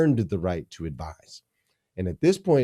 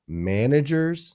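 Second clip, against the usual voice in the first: managers drawn out slowly, higher and louder; stressed managers; 6 semitones higher, 6 dB louder, slower than usual. Shown as a demonstration. Guest contrasting managers with leaders, slow disdainful stress. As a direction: hit managers with scorn. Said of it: "I stress that word".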